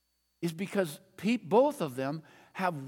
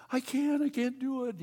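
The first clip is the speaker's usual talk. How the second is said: put-on child crying; 7 semitones higher than usual. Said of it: "she's just sobbing".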